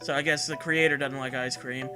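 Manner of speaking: ordinary talk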